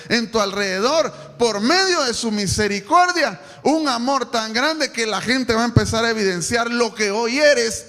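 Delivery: loud, rising preacher's appeal to the church; preaching at full force. Real, not acted. Pastor exhorting the church, preaching at full force. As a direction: preach it out big to the congregation.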